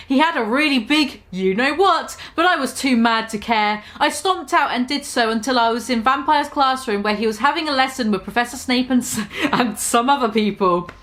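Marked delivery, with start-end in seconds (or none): none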